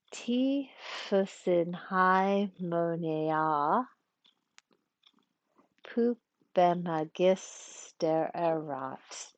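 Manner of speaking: very slow, careful syllable-by-syllable verse; metrical scansion. Shown as a demonstration. Teacher tackles a tough line with Greek words, slow metrical reading. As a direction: feel out each syllable, long break midline. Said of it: "we're gonna practice a little bit".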